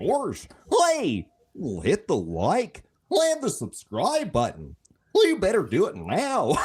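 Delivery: high, slow goofy hey. Shown as a demonstration. Dopey high cartoon dog. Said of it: "let's hear the Goofy".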